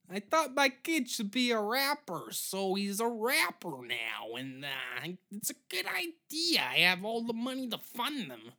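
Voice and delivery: loud, high-pitched comic caricature voice; shoddy Gilbert Gottfried. Acted. Pitching a show as a rich parent, shoddy Gilbert Gottfried impression. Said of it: "that's just a shitty Gilbert Gottfried impression".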